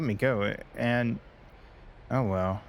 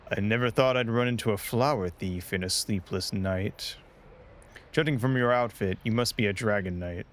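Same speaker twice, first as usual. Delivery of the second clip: normal talking voice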